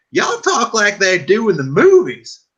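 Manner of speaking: high, slow Southern drawl; mock Southern twang, drawn out; imitating Mississippi guys, Southern accent. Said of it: "these guys are like".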